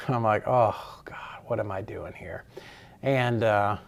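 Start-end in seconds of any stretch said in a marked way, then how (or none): 0.5-2.4 soft whisper to yourself; whispered panic. Retold or imitated. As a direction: breathe it out like nobody should hear. Panicking to himself at meeting.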